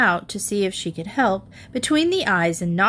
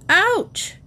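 high, loud ouch; yelp of pain; 11 semitones higher, 3 dB louder than usual. A narrator's reading. Mother struck on the foot, sharp yelp. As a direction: yelp as the broom hits. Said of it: "she yelled".

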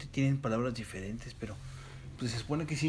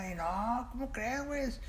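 put-on Tijuana accent; 11 semitones higher, 5 dB quieter than usual. Describing Tijuana show, high Tijuana accent. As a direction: mimic a Tijuana guy joking. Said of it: "donde hablan así".

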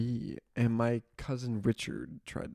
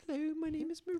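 much higher, softer mock French accent; mock French girl; 9 dB quieter, faster than usual. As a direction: introduce yourself like a sweet French exchange student. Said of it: "Dude, her voice".

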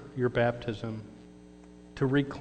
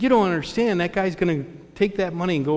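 loud, higher, exasperated; indignant protest; 5 semitones higher, 5 dB louder, faster than usual. Retold or imitated. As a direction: push back hard on him. Keith objecting, livid.

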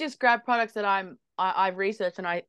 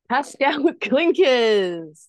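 grand reveal; 4 semitones higher, 5 dB louder, much slower than usual. Acted. Drum roll.

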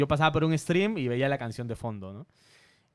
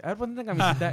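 higher, louder mock of the friend giving orders; imitating the director friend; 8 semitones higher, 4 dB louder, faster than usual. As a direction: boss him around like a cheeky director, pitched up. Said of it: "me dice". (imitando al amigo que da órdenes, más agudo y fuerte)

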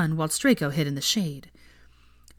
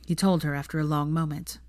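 usual speaking voice

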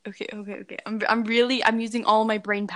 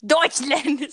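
loud mock German exclamation; 6 dB louder than usual. Joking after asking for a German accent, mock German shout.

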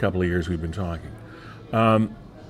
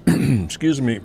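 clearing throat after choking on water; 5 semitones higher, slower than usual. Real, not acted. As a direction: cough it clear, a bit strained. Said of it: "Water went down the wrong way".

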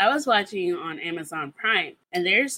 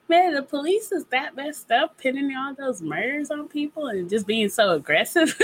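naive self; 7 semitones higher than usual. Retold or imitated. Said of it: "I'm just so, like, so naive".

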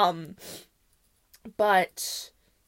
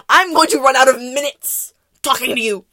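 loud, exasperated complaint; loud annoyed; 4 semitones higher, 14 dB louder than usual. Retold or imitated. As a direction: snap back at her on the phone. Annoyed at repeat calls.

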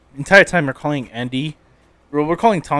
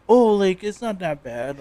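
mock dismissive excuse; 6 semitones higher, slower than usual. Mimicking people who downplay abuse, mock dismissive. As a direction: play a mock excuse, trailing down. Mocking partners' lame excuses.

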